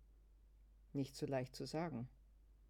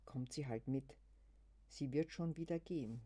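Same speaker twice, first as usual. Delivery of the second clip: plain reading voice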